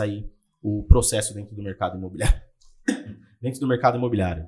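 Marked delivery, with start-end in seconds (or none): 3.4-4.4 cough, then fast words; dry cough. Real, not acted. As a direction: clear a cough and rush on. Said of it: "Desculpa a tosse aí".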